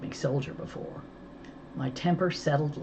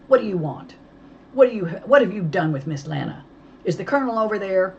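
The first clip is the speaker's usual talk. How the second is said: louder, higher, pressing fast; angry demand; 5 semitones higher, 7 dB louder, faster than usual. A narrator's reading. Mo demanding to know where Miss Lana is. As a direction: demand answers, furious. Girl confronting kidnapper by phone.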